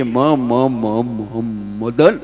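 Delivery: stuck on "મ" before "Madan"; comic stutter; stammering. Retold or imitated. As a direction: stammer "મ, મ, મ" then get out "Madan". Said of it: "પાંચ વાર મ આવે".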